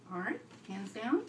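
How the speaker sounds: plain reading voice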